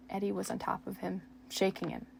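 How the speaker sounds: plain reading voice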